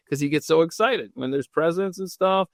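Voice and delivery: usual speaking voice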